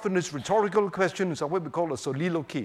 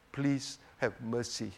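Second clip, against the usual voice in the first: softer, lower, slow plea; hushed slow plea for mercy; humble soft prayer; 6 semitones lower, 8 dB quieter than usual. Shown as a demonstration. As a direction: ask slowly and quietly for mercy. Voicing the best prayer.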